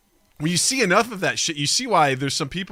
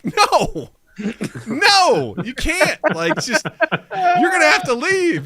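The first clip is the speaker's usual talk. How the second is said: higher, louder refusal breaking into laughs; refusing through laughter; 11 semitones higher, 5 dB louder, much slower than usual. Retold or imitated. Clerk refusing the pimp, laughing, loud and high. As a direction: laugh while telling him no. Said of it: "I'm like".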